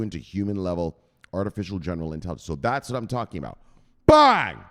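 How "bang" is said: sudden loud high exclamation; excited shout. Real, not acted. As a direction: burst out with it, full volume. Hyping the AGI breakthrough.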